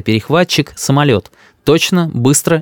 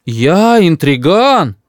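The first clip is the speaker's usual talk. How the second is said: very slow, higher-pitched disbelief; astonished; 5 semitones higher, much slower than usual. A narrator's reading. Grandfather answers grandmother's accusation, astonished. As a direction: stretch out each word in amazement. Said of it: "удивляется дедушка".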